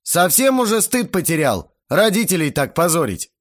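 angry scolding. A narrator's reading. Older man berates his host's son, loud and indignant. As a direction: tell the boy off loudly.

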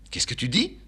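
put-on high, startled old man; shocked father. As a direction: gasp out the question.

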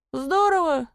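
high-pitched, eager approval; eager agreement. A narrator's reading. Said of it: "закивал Гриша". (радостное согласие высоким голосом)

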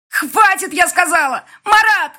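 high, full-force furious shout; yelling. A narrator's reading. Woman orders two brawling men to stop, yelling. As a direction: scream to break up a fight. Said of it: "Лина просто орала на нас".

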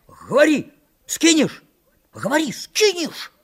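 high, forceful cries in short bursts; shouted demand. A narrator's reading. Санька shakes Митька for an answer, angry high shouts. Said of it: "закричал Санька, встряхивая Митьку за шиворот".

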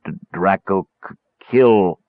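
gurgle through each cut-off word; lightly voiced terrified creature; stammering creature. A narrator's reading. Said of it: "speech was practically unrecognizable".

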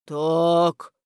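ordinary delivery

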